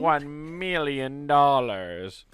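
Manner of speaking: slow, drawn-out evil villain; pinky to mouth, savor each word. Pretend. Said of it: "with the pinky to the mouth".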